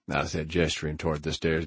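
plain reading voice

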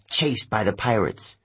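plain reading voice

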